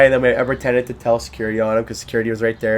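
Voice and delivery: ordinary talk, nothing marked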